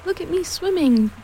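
high-pitched little squeak, showing off. A narrator's reading. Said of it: "squeaked Roo".

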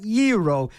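"gyro" said as YEE-roh; rejected pronunciation. Shown as a demonstration. Host rejects the Greek-style version, pronounced YEE-roh. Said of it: "It is not gyro".

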